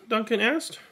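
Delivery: ordinary delivery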